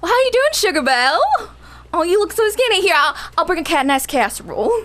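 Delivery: high, sugary Southern hostess; Southern accent. Shown as a demonstration. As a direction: greet a guest in a high-pitched Southern accent. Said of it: "Make me Southerner".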